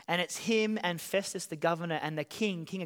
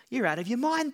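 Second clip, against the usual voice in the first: loud exclamation; 5 semitones higher, 4 dB louder than usual. Retold or imitated. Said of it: "Festus exclaimed in a loud voice".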